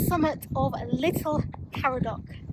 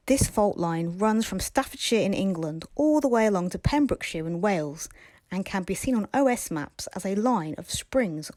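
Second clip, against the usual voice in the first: lower, calm voiceover; documentary narration; 5 semitones lower, 4 dB quieter than usual. A narrator's reading. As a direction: read it like a nature documentary.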